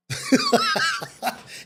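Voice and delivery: high, sudden burst of real laughter. Real, not acted. Mocking a weak quarterback, he bursts into high laughter. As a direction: crack up at his own harsh line. Laughs at his own harsh quip.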